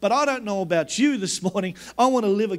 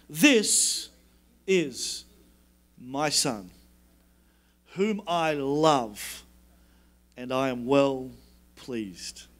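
a hushed, loving father speaking to his son; God's voice; 7 semitones lower, much slower than usual. Retold or imitated. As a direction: lower the voice and let each phrase land gently. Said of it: "And He hears this voice".

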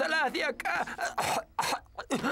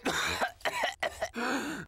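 dry sick cough. Acted. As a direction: cough weakly while ill. Clarence enfermo tose. (tos de enfermo)

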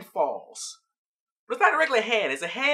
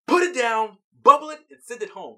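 louder, commanding order; firm orders; 4 semitones higher, 6 dB louder than usual. Retold or imitated. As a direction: bark the orders to the team. Garnet orders urgently.

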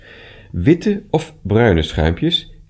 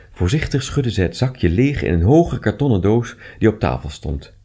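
ordinary delivery

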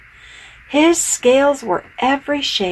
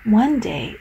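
usual speaking voice

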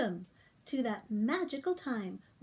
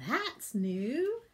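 ordinary delivery, nothing marked

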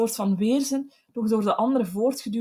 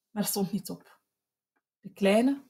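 ordinary delivery, nothing marked

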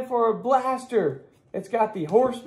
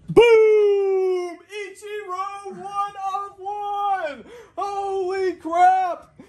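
excited; 6 semitones higher, slower than usual. Real, not acted. Pulls one of one Ichiro.

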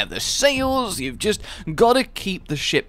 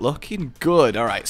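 ordinary talk

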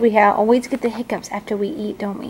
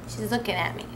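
usual speaking voice

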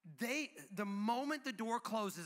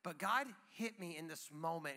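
normal talking voice

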